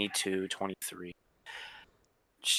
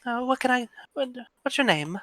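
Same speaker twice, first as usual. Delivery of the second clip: put-on higher, light young woman voice; 10 semitones higher than usual. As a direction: play her sweet and polite.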